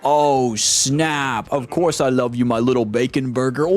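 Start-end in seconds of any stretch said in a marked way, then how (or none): none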